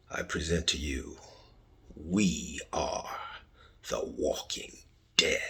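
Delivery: unvoiced dramatic whisper of the title. Shown as a demonstration. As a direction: breathe the show name out like a secret. Hushed repeat of show title.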